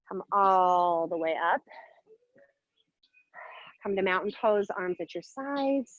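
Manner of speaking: a hint of meditation voice; guided calm.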